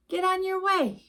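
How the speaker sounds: ordinary delivery, nothing marked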